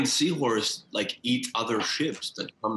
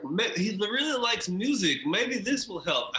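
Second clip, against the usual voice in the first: high-pitched, eager little voice; hopeful character musing; 10 semitones higher than usual. Acted. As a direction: think aloud in a high character voice. Character muses on helping friend.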